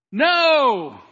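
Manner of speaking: loud, high, drawn-out cry; inner shouted no. Retold or imitated. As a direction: shout it like a mind screaming its answer. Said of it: "remember my mind going".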